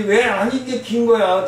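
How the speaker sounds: ordinary talk, nothing marked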